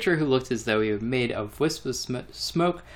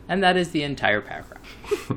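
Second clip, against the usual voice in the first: ordinary talk, nothing marked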